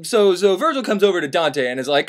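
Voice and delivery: ordinary talk, nothing marked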